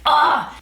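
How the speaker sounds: high, exasperated "ah!"; short, high burst of frustration; exasperated cry. Retold or imitated. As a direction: let out a high cry of annoyance. Exasperated at being nudged, retold.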